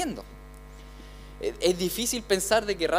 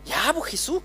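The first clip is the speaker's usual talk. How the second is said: higher, pleading disciple on the sinking boat; a disciple pleading with Jesus in the storm, higher and pleading; frightened disciple; 5 semitones higher than usual. Acted. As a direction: cry out to the sleeping master.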